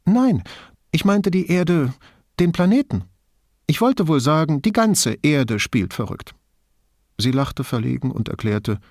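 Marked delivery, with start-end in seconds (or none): 0.1-6.3 high, loud sudden relief; relieved brightening. A narrator's reading. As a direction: brighten up as if escaping. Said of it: "strahlte sie auf".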